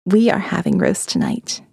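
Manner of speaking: plain reading voice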